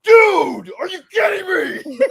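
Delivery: sudden high, loud shout of disbelief; screaming in his face. Retold or imitated. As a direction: yell it right at your friend's face. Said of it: "I straight up screamed in his face".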